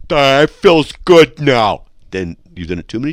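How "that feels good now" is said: slow, loud, dopey voice after head-banging; goofy stunned drawl; dazed voice. Shown as a demonstration. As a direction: sound punch-drunk and slow. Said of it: "if you start talking like this".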